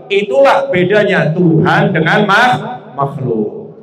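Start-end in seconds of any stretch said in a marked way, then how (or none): none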